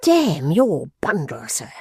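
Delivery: slow, pressed read-aloud anger; irrepressible rage. A narrator's reading. Mister Dorrit refusing John's cigars, enraged. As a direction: spit out the rage, barely held. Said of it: "in irrepressible rage".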